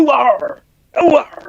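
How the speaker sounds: high, hammed-up farmer's "ooh arr"; West Country yokel cry. Mate salutes the farmers with a mock yokel whoop.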